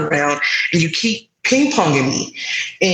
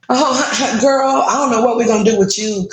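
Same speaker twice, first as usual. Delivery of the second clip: imitated higher-pitched brush-off with a laugh; dismissive clerk; 6 semitones higher than usual. Clerk brushing off a patient's referral question, mock dismissive. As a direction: mimic a clerk laughing off the caller.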